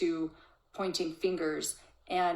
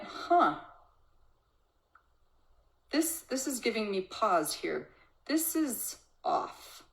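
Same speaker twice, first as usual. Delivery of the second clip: grounded mom noticing; 3 semitones higher than usual. Retold or imitated. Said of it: "This mom stood her ground".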